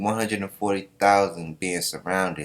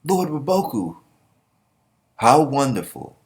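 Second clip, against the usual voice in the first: admiring soldiers; 6 semitones higher, 4 dB louder than usual. Retold or imitated. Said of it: "his son are laughing".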